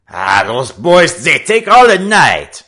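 high, loud French exclamation; exclaiming. A narrator's reading. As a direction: call out in impatience. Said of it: "they heard French Pete exclaim".

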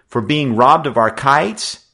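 bristling at the injustice; hot demand. A narrator's reading. Joe to the gang leader, loud hot demand. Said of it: "Joe demanded hotly".

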